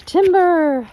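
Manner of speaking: loud, high shouted call; yelled warning. Retold or imitated. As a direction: belt it out as the tree comes down. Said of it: "I yelled".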